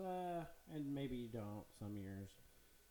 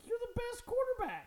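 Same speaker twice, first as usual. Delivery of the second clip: mock fan squeal, much higher and louder; gushing fan; 6 dB louder than usual. Everyone telling him he's the best, as a mock fan squeal. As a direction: gush like a starstruck fan. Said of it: "And everyone's like".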